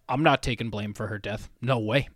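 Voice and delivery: low, soft, deadpan refusal; imitated adamant dad. Host quotes his dad refusing the blame, low deadpan. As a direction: drop low and quiet, firmly refusing. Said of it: "he's just like".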